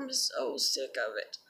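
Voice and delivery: low, quiet, deflated venting; weary, fed up. Real, not acted. Speaker venting about people mocking them, weary and fed up. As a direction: let the voice drop, drained and fed up.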